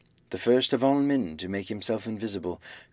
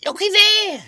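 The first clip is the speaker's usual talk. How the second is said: put-on very high, loud old woman's shriek; sharp scream; 10 dB louder than usual. A narrator's reading. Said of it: "screamed sharply".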